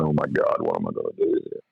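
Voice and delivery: the producer groaning to himself over a hopeless first take; despairing mentor. Retold or imitated. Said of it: "He's shaking his head going".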